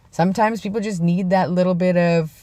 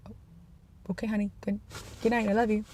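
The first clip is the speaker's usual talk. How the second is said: soft goodnight; 4 semitones higher, 11 dB quieter, faster than usual. Retold or imitated. Tender goodnight to sleepy wife.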